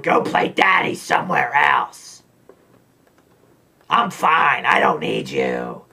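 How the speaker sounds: put-on voice, snapping back; mock angry snap. Lizzie snapping at Arnold, mock angry put-on voice. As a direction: brush him off with mock anger. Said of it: "pissed off at Arnold".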